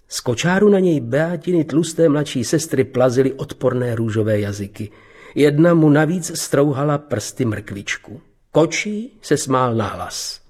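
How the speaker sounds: usual speaking voice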